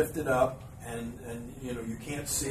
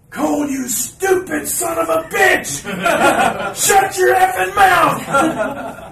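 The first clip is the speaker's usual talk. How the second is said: slow, much higher, louder raging yell; furious scream; 15 dB louder, much slower than usual. Retold or imitated. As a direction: boss screaming into the headset. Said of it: "Vince McMahon screams".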